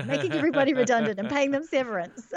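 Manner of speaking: self-conscious attempt at an American accent. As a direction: put on a playful American accent. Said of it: "Speaking like an American".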